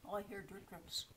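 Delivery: ordinary talk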